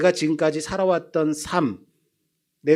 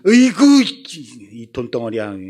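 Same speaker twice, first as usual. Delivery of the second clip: exasperated scolding; 7 dB louder than usual. Retold or imitated. Father scolding son over lost glasses, loud and exasperated. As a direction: scold the boy like a costly nuisance. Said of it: "으이구" 안에는 많은 의미가".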